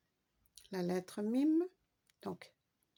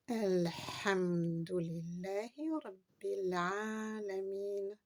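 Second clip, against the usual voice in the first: Elhamdulillahi Rabbil Alamin read very slowly with the liaison; slow recitation; much slower than usual. Shown as a demonstration. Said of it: "Faites bien la liaison". (récitation lente avec liaison)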